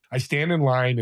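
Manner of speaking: normal talking voice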